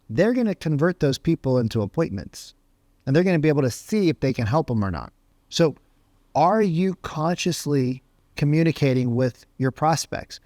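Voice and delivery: usual speaking voice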